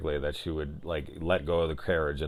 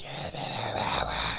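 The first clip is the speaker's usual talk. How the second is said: drawn-out eerie whisper; 3 semitones higher, 6 dB quieter than usual. Retold or imitated. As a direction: stretch the word in a creepy whisper. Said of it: "I did really like the whispering".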